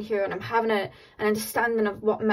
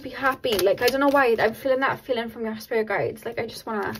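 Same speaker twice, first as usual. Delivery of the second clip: ordinary delivery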